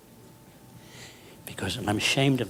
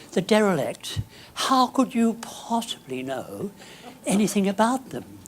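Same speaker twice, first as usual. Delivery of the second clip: posh lady; 7 semitones higher than usual. Retold or imitated. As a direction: mimic a condescending posh interviewer. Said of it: "this rather posh lady says to me".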